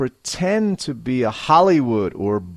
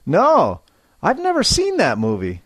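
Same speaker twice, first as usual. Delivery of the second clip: higher, fast, stress on never; emphatic denial; 5 semitones higher than usual. Shown as a demonstration. Teacher modelling a firm no for students. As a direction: insist you never saw it. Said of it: "a little more feeling and life".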